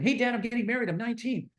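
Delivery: put-on high-pitched, eager young son; eager announcement. Young self announcing wedding to dad.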